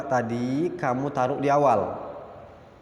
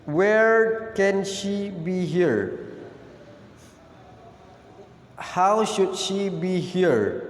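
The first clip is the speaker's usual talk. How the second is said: drawn out, slightly higher, almost chanting the examples; slow sing-song model questions; 4 semitones higher, much slower than usual. Shown as a demonstration. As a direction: sing the questions slowly for the class. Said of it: "jangan lupa ditambah be". (kalimat contoh dilantunkan pelan)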